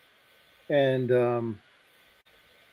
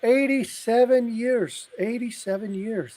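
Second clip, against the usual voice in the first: mock high-pitched lady calling her friend over; other lady voice; 9 semitones higher than usual. Lady at the grave calls her friend over, in a mock high voice. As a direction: squeal it up high like an excited old lady. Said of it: "And the other lady says".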